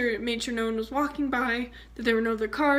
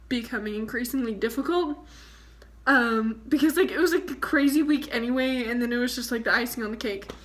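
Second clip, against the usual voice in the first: ordinary delivery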